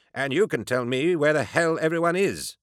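high, querulous doctor's voice; haughty retort. A narrator's reading. Doctor demands the enforcer tell where everyone is, haughty. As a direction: throw it back with piqued authority.

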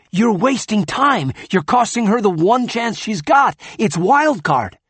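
high, loud, pressing argument; urgent insisting. Acted. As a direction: push him to act now. Finn urges the injection.

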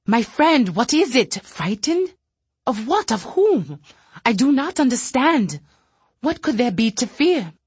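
puzzled. Acted. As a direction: ask in bewildered disbelief.

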